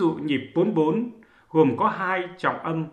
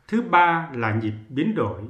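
ordinary delivery

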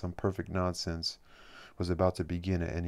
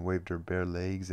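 plain reading voice

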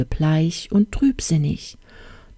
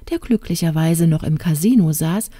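ordinary delivery, nothing marked

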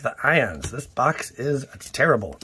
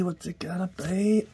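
excited; 8 semitones higher than usual. Acted. Same words voiced with rising tune.

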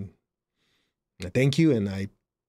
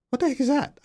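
puzzled; 12 semitones higher than usual. Retold or imitated. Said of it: "I'm going".

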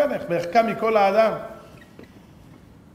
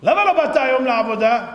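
high, loud demand for an answer; loud questioning; 8 semitones higher, 6 dB louder than usual. Acted. Ox asking the donkey why he skipped work, loud. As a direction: call it out to an absent workmate.

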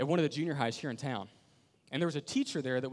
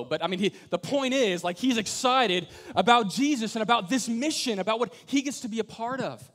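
much higher and louder, fired up; excited; 9 semitones higher, 9 dB louder than usual. Real, not acted. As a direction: build up the excitement. Preacher's rising enthusiasm.

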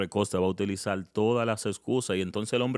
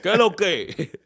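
laughing; 8 semitones higher, 6 dB louder, slower than usual. Pretend. Playful bit, laughing.

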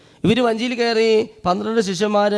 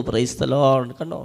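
usual speaking voice

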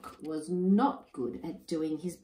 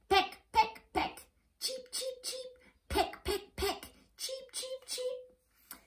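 much higher tiny pecks and cheeps, soft; chick sounds; 5 dB quieter, slower than usual. Acted. Chicks pecking out of eggs, high tiny cheeps. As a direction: chirp like hatching chicks.

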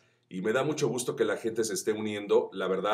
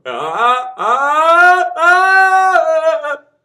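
rising, much higher and louder cries; desperate scream; 16 dB louder, much slower than usual. Shown as a demonstration. Vocal coach demonstrating to students a desperate, climbing scream. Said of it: "El grito puede ser de desesperación".

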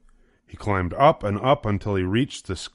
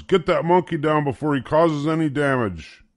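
commanding director; 8 semitones higher than usual. Acted. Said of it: "he ordered".

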